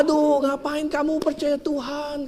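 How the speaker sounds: high, slow mocking sneer; mock the old woman's faith; mocking atheist. (ejekan tinggi dan lambat)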